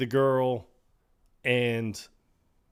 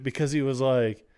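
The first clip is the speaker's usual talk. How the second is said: normal talking voice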